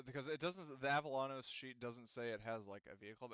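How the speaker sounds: normal talking voice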